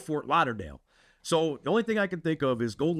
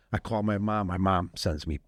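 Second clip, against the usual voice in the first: usual speaking voice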